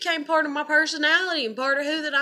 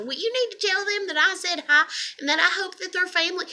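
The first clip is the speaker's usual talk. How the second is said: higher-pitched, insistent run of questions; imitated nosy grandmother; 6 semitones higher than usual. Grandmother grilling a grandchild about classmates, imitated nosy voice. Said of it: "her being like".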